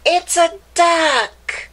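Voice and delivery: put-on spring the answer on the kids; storybook surprise; excited reveal. Reveal to children.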